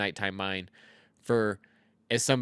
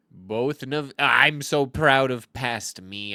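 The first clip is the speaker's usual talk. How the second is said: ordinary delivery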